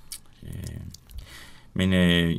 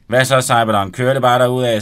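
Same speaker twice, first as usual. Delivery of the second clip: loud greeting; 3 semitones higher, 10 dB louder than usual. Acted. Benny greeting his boastful friend, loud and cocky. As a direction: call out cheerfully at the door.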